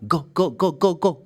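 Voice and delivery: rapid-fire repeated "go", high pitch; stressing the hard g of "go"; letter sound demo. Shown as a demonstration. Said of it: "The sound is like this".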